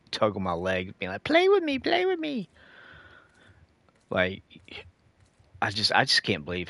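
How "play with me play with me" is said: put-on very high squeaky kid voice; pestering little cousin. Little cousins tugging on his leg, squeaky pestering whine. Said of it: "more annoying than my little cousins".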